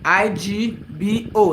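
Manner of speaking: slow letter-by-letter spelling. Shown as a demonstration. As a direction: spell each letter slowly and separately. Spelling the word for viewers.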